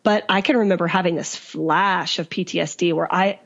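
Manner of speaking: ordinary talk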